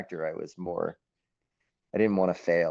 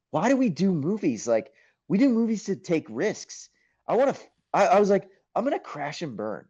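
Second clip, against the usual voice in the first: higher, half-laughing rallying cry to himself; fired-up self pep talk; 8 semitones higher than usual. Retold or imitated. Actor psyching himself up about the role, fired up and higher. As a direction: psych yourself up to take the risk.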